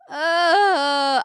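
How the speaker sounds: long, loud, high groan; exasperated groan. Acted. Streamer told to look up a name, groaning loudly.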